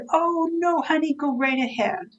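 a light hint of a sweet old lady, starting high on "Oh, no"; cheery Mary reply. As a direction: mildly voice the elderly client giving in gladly. Mary cheerfully lets her take coat.

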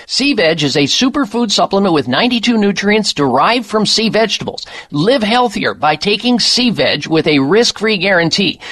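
upbeat announcer voice; ad read. Acted. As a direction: sell it like a radio ad. Recorded ad read.

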